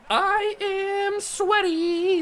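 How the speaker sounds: high, drawn-out excited cry; slow, high-pitched thrill; excited exclamation. Real, not acted. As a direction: stretch the words out high and slow. Tense close game, celebrating.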